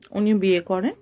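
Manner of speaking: plain reading voice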